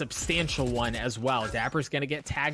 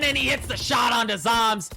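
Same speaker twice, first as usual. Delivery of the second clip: screaming hype, much higher and louder; excited shouting; 13 semitones higher, 6 dB louder than usual. Real, not acted. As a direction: lose it over the big play. Caster hype over a four kill.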